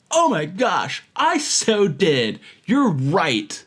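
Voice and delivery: mock-sincere sarcasm, stretched out; sarcastic. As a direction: gush with exaggerated fake agreement. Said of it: "he starts getting sarcastic".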